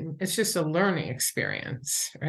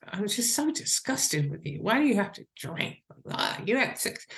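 higher-pitched mock nagging; acted-out shaming spouse; disgusted; 4 semitones higher than usual. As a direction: scold them with exasperated disgust. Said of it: "not of disgust".

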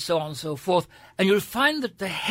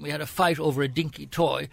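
normal talking voice

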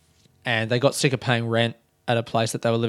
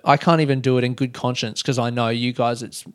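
usual speaking voice

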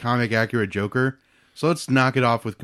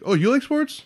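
high mocking imitation. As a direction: imitate a condescending stranger. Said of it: "irks me a little bit that it's like".

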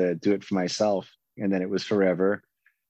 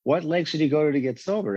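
higher-pitched retold telling-off, no real shout; badgering in a raised pitch, mildly mock-yelled; mock yelling; 7 semitones higher, faster than usual. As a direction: imitate a pushy man pressing his point. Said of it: "He's, like, yelling at me".

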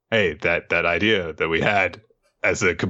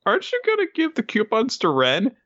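high, squeaky little creature voice; hermit crab. Acted. Crabs press their leader about coupons, high creature voice. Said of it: "his hermit crabs say".

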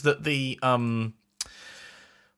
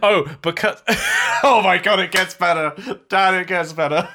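laughing; 4 semitones higher, 4 dB louder than usual. Real, not acted. Laughing while announcing more.

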